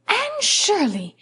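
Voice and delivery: high, slow cry of astonishment; surprised exclamation. Acted. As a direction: gasp out her name, caught off guard. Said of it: "exclaimed Marilla".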